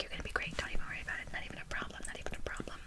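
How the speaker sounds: hushed, soothing ASMR whisper; reassuring whisper. Acted. Tutor reassuring a great student, whispered. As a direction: whisper encouragement to calm them.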